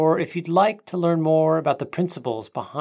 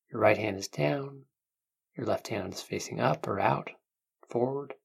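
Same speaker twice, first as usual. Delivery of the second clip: calm guiding voice, unhurried; guided meditation. Acted.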